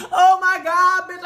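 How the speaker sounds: loud, high mock panic; panicked exclamation. Running up the winding staircase to get dressed, panicked exclamation. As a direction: cry out in hammed-up alarm.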